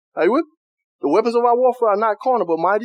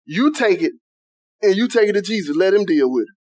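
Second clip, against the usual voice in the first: usual speaking voice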